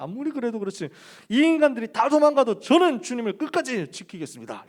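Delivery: loud, high-pitched pledge of loyalty; fervent vow. Retold or imitated. Peter boasting to Jesus he will stay, fervent vow. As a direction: swear it with hot-headed zeal. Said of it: "되게 뜨거워".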